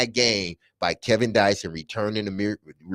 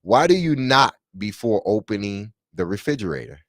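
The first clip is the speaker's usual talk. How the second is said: ordinary delivery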